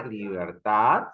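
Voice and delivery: "libertad" slow, with a long rising TAD; stress on final syllable. Shown as a demonstration. Teacher showing the stronger last syllable, stressed "libertad". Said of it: "yo pronuncio la última sílaba".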